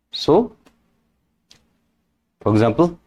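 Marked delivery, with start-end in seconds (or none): none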